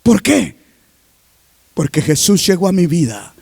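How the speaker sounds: usual speaking voice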